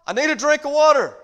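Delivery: put-on high, loud little boy calling Mama; child calling out. Little boy calls from upstairs to his mama, high and loud. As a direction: call up the stairs like a scared little boy. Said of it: "here's what he'd say".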